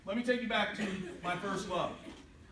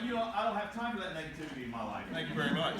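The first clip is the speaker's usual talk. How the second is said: quick, lower comeback; mock dismissive; 6 semitones lower, faster than usual. Dad answering his teasing girls, mock dismissive. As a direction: brush them off quickly in a lower voice.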